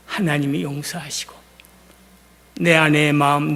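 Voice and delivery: ordinary delivery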